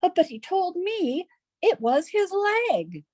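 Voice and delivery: high, slow, baffled soldier voice; a dim soldier, slow and lost; puzzled soldier. A narrator's reading. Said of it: "Then after a pause, he added".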